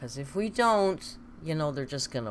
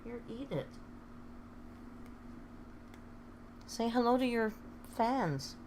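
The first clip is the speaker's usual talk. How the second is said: pet talk; 7 semitones higher, 6 dB quieter than usual. Real, not acted. Owner coaxing her pet to greet fans, high baby talk. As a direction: coo at the little animal.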